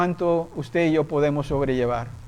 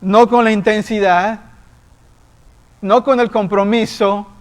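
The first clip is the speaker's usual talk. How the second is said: firm rebuke; 3 semitones higher, 3 dB louder than usual. Retold or imitated. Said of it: "me dice".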